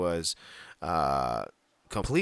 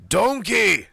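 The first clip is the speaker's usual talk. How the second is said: much higher, loud mock Scottish yell; Shrek shouting donkey; 12 semitones higher, 10 dB louder than usual. Attempting a Scottish voice, ends up shouting like Shrek. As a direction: bellow it like a cartoon ogre. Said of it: "Drew is Scottish, so you'd have to be like".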